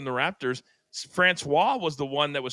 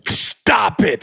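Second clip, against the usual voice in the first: disbelief; 5 semitones higher, 10 dB louder than usual. Real, not acted. Host reacting to iguana story.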